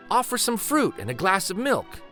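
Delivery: plain reading voice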